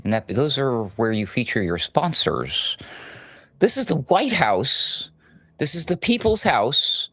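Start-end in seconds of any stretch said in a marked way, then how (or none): 3.6-7.1 slow, high, grandly mock-reverent; sarcastic high solemn proclamation. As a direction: intone it like a sacred declaration, mocking.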